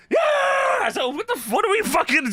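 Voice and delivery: sudden high-pitched cheer; imitated burst of high-pitched hype; mock excited yell.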